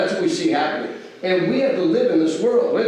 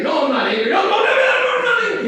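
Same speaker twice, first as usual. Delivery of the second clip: escalating mock-defensive protest, pitch climbing high; defensive denial. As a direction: deny it in a squeaky, frantic rush. Denying anger while clearly angry.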